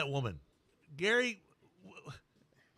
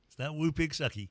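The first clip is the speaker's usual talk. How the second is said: ordinary talk, nothing marked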